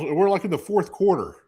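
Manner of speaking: ordinary talk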